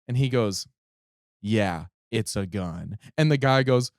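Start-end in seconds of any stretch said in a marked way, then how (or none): 1.4-3.0 sarcastic. Retold or imitated. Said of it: "him being sarcastic".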